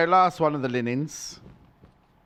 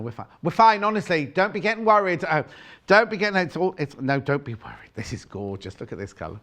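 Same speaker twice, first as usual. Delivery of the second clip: usual speaking voice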